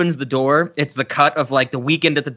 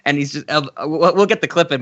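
ordinary delivery

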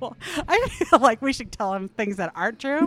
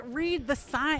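ordinary talk, nothing marked

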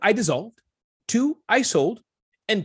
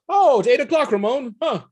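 higher, louder awkward host voice; embarrassed host; 6 semitones higher, 5 dB louder than usual. Retold or imitated. Guest acts out the awkward host greeting sponsors, higher and louder. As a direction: act out the awkward moment. Retelling empty event with sponsors.

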